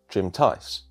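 usual speaking voice

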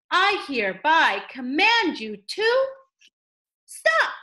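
ordinary delivery

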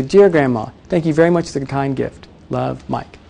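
soft, intimate letter-reading; low-key, falling away to a hushed sign-off; handwriting voice. Shown as a demonstration. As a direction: read it quietly, as a personal note. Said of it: "That's your handwriting voice".

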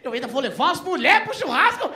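high, loud indignant outburst; shocked shout, laughing; outraged shout. Retold or imitated. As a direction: explode in disbelief. Outraged refusal to bring women.

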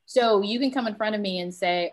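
ordinary talk

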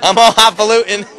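laughing. Real, not acted. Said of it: "He's all highfalutin on us".

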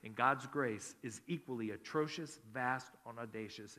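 forceful preaching. Acted. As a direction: preach it hard from the pulpit. Pastor preaching at full force.

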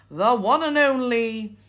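ordinary talk, nothing marked

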